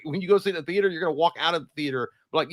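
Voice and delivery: normal talking voice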